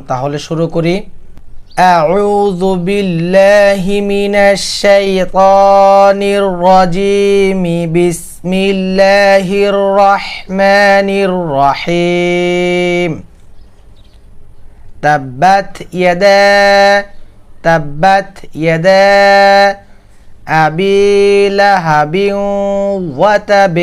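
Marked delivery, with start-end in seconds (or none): 2.0-13.1 drawn-out chanted tilawat, slow; slow Quran recitation. Shown as a demonstration. Teacher opening the lesson with slow recitation. 15.0-23.8 chanted Quran line for students; recitation demo. Shown as a demonstration. Teacher reciting for students to copy. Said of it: "খেয়াল করুন".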